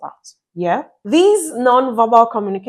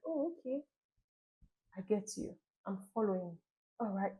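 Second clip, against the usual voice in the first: much softer, gentle nods in words; soft attentive listener; 17 dB quieter than usual. Pretend. Spouse listening closely while partner talks, softly affirming. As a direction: murmur quiet encouragement to your spouse. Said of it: "you're like".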